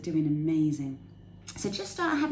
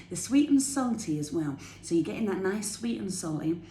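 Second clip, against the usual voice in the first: usual speaking voice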